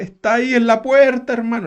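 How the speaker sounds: mock weepy prophet; tearful visionary. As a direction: cry it out as a vision. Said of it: "con un llanto que le salía como de acá".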